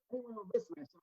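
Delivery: ordinary talk, nothing marked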